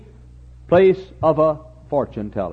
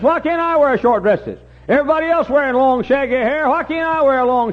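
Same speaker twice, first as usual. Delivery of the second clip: high-pitched, hammed-up complaining; high, insistent mock clamor; mock pleading; 10 semitones higher, 3 dB louder than usual. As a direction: imitate youngsters begging to do what everybody does. Mimics young people pleading to copy others.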